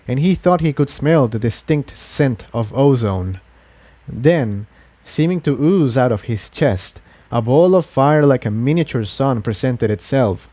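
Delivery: ordinary delivery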